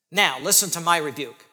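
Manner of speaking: usual speaking voice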